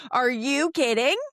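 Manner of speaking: usual speaking voice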